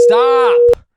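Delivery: high, loud protesting stop. Real, not acted. Host stopping co-host from naming the place, loud. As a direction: shut him down.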